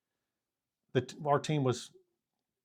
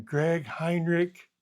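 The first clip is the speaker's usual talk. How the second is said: slow, higher drawn-out Lou Holtz call; 5 semitones higher, 3 dB louder, much slower than usual. Retold or imitated. Coach calling his kicker again, slow and drawn out. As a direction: stretch the name out like Lou Holtz. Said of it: "best Lou Holtz voice".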